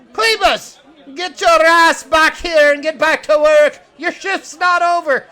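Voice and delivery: high, full-volume boss's yell; angry yell. Acted. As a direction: holler at a straying worker, loud and high. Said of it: "Tala yells".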